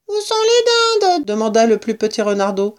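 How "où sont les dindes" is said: put-on very high child's voice; little fox cub. A narrator's reading. Smallest cub looking for turkeys, high piping question.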